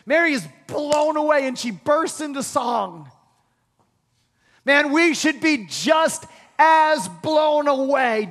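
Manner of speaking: ordinary talk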